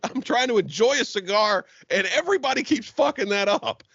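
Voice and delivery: high-pitched put-upon whine; indignant high mock. Friend Judas whining about his beer being ruined, high mock voice. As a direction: squeak it like an annoyed friend. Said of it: "And Judas goes".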